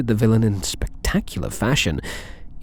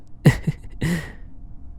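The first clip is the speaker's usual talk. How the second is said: airy soft laugh, barely voiced; a quiet teasing laugh; breathy soft chuckle; 7 semitones higher, 5 dB quieter than usual. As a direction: chuckle under your breath. Teasing after "possibly in that order.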